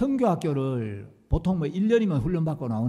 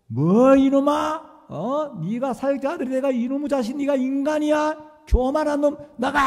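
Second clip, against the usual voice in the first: loud, higher, furious scolding; stern pastor's rebuke; 9 semitones higher, 7 dB louder than usual. Retold or imitated. Pastor roaring at a boastful student, furious shout. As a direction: roar at the student like an outraged elder. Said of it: "두 눈을 부릅뜨시고".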